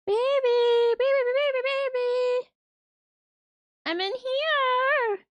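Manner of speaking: high, loud call to a lost cat; real high call to the cat. Real, not acted. Calling out to the cat.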